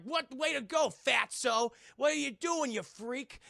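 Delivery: mock angry jeer. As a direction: jeer indignantly as the food-covered jock. Acts out Duncan jeering at Fred.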